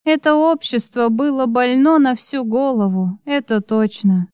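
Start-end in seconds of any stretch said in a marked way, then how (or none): none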